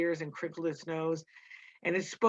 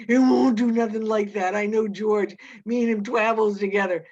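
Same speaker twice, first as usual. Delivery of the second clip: loud, panicked insistence; desperate to convince himself; frantic; 4 semitones higher, 9 dB louder than usual. A narrator's reading. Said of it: "said Lenny frantically".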